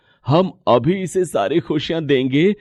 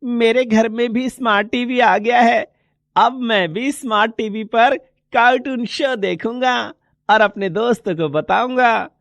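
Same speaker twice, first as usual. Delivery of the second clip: put-on excited child; 6 semitones higher than usual. Child Deepak's voice.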